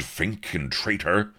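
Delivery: traitor said at speaking pitch, stressed; accusing. A narrator's reading. Avery accuses.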